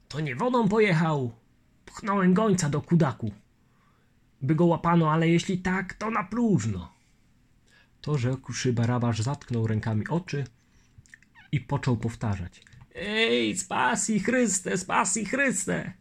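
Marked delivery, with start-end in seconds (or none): 0.1-6.9 put-on higher, anxious old man's voice; fretting old colonel; old colonel worried. Barabasz fearing Chmielnicki's escape, worried old voice. 12.9-15.9 high, slow pleading lament; old man lamenting. Acted. Barabasz covering his eyes, slow frightened lament.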